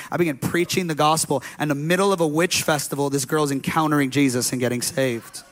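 ordinary talk, nothing marked

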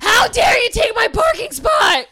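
high, drawn-out outraged shout; Mitch. Acted. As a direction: bellow slowly in mock fury, pitch way up. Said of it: "This is my impression of Mitch".

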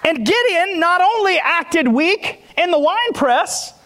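preaching. Acted. Pastor preaching to the church about testimonies, full force. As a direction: fire up the congregation from the pulpit.

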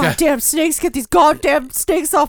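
hammed-up outraged impression; Samuel L. Jackson. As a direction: shout it as an action star fed up. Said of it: "It's not Samuel Jackson, by the way".

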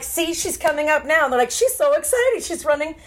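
high, cooing delight; mock excited parents. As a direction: watch her coming with high excitement. Voices parents watching daughter run up.

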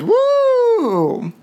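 high whooping cheer; woo. Real, not acted. Host whooping to celebrate Pride with viewers. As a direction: shriek it out with joy.

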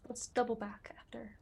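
breathy unvoiced whisper to the party; stage whisper. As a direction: whisper it so the creature won't hear. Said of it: "Yeah, stage whispers".

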